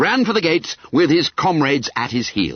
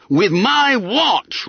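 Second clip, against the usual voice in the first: higher, slow, indignant roar; 12 semitones higher, 3 dB louder, much slower than usual. Acted. Peter roaring at Bunter about his watch. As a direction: bellow it at the fat junior. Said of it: "roared Peter".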